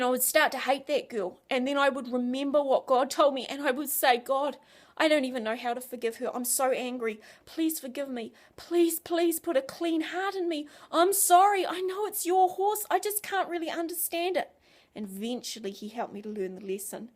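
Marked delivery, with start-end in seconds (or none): 4.2-14.5 pleading prayer. Retold or imitated. Her pleading prayer to God.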